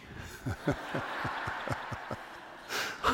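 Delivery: soft airless chuckle; quiet breathy laugh. Real, not acted. Laughter at the bedside.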